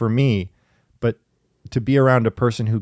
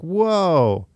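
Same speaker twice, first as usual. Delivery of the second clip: drawn-out, higher-pitched retold whoa; amazed; 7 semitones higher than usual. Recalling her first sight of someone patient with kids, amazed. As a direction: let the wonder swoop up high.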